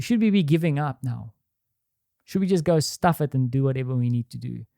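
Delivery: ordinary delivery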